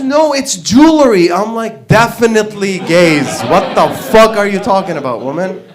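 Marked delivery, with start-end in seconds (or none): 0.0-1.3 loud, high-pitched mock insistence; exasperated correction. Wife correcting her husband about the theft, exasperated. 1.9-5.6 louder, higher, exasperated certainty; emphatic insistence. Acted. Husband insisting to his wife, loudly exasperated. Same verdict, escalated to exasperation.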